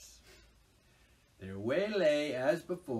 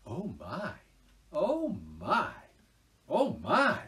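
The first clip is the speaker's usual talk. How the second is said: rising, ever louder cries of delight; little rabbits, more thrilled each time; excited rabbits; 4 semitones higher, 6 dB louder, slower than usual. Acted. Rabbits greet passing cart.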